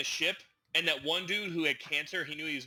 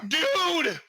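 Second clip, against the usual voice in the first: a high, loud "Dude!"; excited shout; 12 semitones higher, 6 dB louder than usual. Real, not acted. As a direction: burst out in excitement. Excited call to friend.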